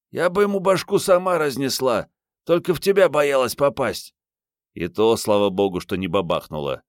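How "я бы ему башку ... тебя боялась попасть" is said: put-on high-pitched feisty young woman; бойкая героиня книги. A narrator's reading. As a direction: insist on it in a high girlish voice. Голос героини Мариетты, оправдание. (задиристо, высоким девичьим голосом)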